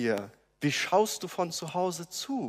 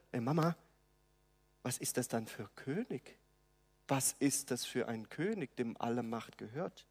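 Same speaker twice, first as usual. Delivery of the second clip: put-on child asking; 3 semitones lower, 11 dB quieter than usual. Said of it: "fragt seiner Mutter".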